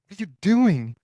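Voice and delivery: high, loud startled question; imitating a buddy caught off guard, yelling; startled shout. Buddy startled to find the hunter on the ground, high loud yell.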